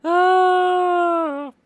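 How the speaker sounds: long squealed "ah" after laughing; loud, high-pitched laughing-fit wail. Real, not acted. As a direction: let out a long, very high cry of mirth.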